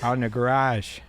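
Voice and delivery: tipsy Chicago regular; thick Chicago vowels, a little boozy; drunk Chicago accent. Acted. Drinker inviting pals for beers, drunk Chicago accent. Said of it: "Like a drunk Chicagoan".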